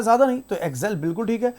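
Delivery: ordinary delivery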